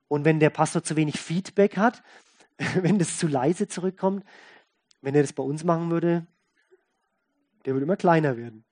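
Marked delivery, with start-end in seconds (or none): none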